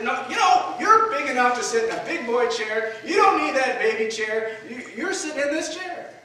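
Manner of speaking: exasperated father scolding his son; scolding dad. Retold or imitated. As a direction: lay down the law to the boy, loud and high. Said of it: "And I said".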